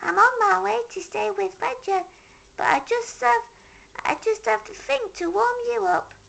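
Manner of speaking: high small hedgehog voice; Little Hedgehog. A narrator's reading. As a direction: sympathetic little creature, high. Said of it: "said Little Hedgehog".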